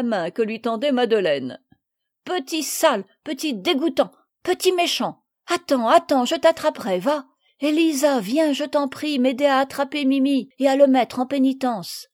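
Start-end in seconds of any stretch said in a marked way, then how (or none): 2.2-12.1 higher, louder indignant scolding; indignant outburst. Acted. Madeleine scolding the dirty bird and calling Élisa, indignant. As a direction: cry out in disgust, then call for help.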